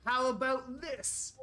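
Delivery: high-pitched taunting hag; game master's high villain voice; taunting villainess. Acted. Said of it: "And she goes".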